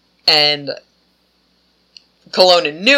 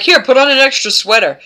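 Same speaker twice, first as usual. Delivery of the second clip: higher, louder cheery offer; mock helpful officer; 7 semitones higher, 4 dB louder than usual. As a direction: hand it over like a useless fix. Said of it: "when they were like".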